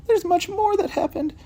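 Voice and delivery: put-on very high, sleepy old cat voice; Missus Hudson's cat voice. Cat Missus Hudson tells detectives of her lost food, very high voice.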